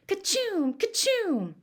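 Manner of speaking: high hammed-up fake sneezes; mock sneezes. Joe fakes Billy's fit, hammed-up sneezes. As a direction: sneeze hard, twice.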